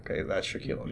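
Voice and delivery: hushed whisper to himself; whispered aside. Retold or imitated. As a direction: breathe it like a secret realization. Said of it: "and like".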